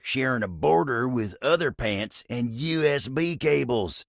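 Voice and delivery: normal talking voice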